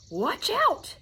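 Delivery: high, loud alarm call; yelled warning. A narrator's reading. Boy warning just before the crash, yelling. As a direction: yell to stop the disaster. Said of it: "and then he yelled".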